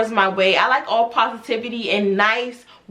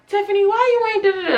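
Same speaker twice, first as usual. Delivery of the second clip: mock yell; 8 semitones higher, faster than usual. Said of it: "yelling at her".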